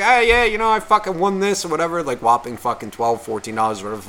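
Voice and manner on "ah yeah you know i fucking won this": lightly voiced, higher-pitched boastful dad; a hint of a brash customer bragging at the counter; brash father. Father boasting to the clerk about a win, lightly imitated brashness. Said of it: "picturing the way that his father's talking".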